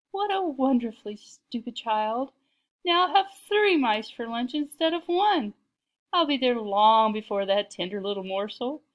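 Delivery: loud, high, scheming glee; chuckling to himself over the scheme; gleeful, giggly cat. A narrator's reading. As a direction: gloat aloud like a delighted villain cat. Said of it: "he giggled".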